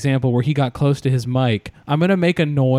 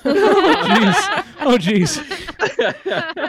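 laughing jeez; 13 semitones higher, much slower than usual. Real, not acted. Reacting to ominous joke.

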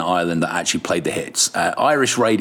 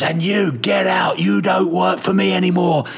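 angry yelling boss; 8 semitones higher than usual. Retold or imitated. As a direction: bark it like a furious boss firing someone. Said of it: "He goes".

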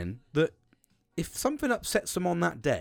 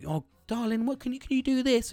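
higher, sugary mock ask; mock sweet partner; 9 semitones higher than usual. To the man, mocking his partner's sugary request.

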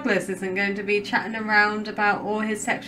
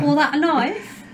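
put-on Aussie accent; 6 semitones higher than usual. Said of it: "It's terrible".

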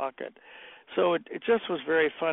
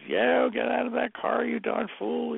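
higher-pitched imitation of yelling; retold yell; 9 semitones higher than usual. Irritated friend ordering him out of the car, mock yelling. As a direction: imitate a cross man yelling from a distance. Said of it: "He started yelling at me".

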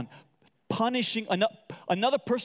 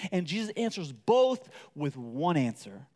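ordinary delivery

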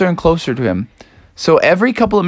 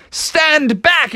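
high, bold command; Froggy warning the boarding mooses; brave shout; 10 semitones higher than usual. Acted. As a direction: warn off the pirates. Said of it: "And Froggy said".

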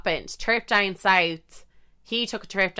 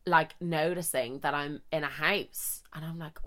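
ordinary delivery

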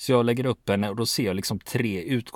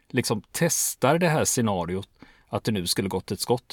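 normal talking voice

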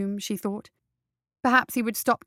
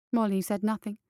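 ordinary delivery, nothing marked

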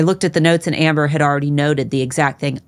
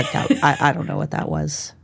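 ordinary delivery

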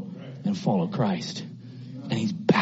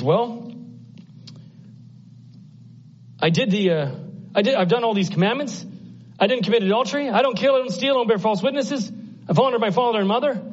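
high, protesting voice listing good deeds; pitched-up, defensive acted reply; self-justifying rich young ruler; 11 semitones higher, faster than usual. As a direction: plead your record like an earnest young ruler.

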